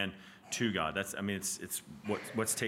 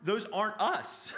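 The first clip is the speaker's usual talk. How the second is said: raised pitch, slowing to hammer the key words; impassioned preaching; 9 semitones higher than usual. Real, not acted. Teacher pressing on the class why the Redeemer must be both, impassioned preaching. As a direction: preach it with mounting insistence.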